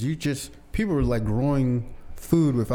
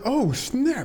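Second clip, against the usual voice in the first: surprised; 12 semitones higher than usual. Retold or imitated. Surprised biting raw turnip.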